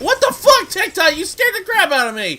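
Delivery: startled shriek, then laughing; scream, then laugh it off; scream into giggles. Real, not acted. Enemy jumps out, sudden scare.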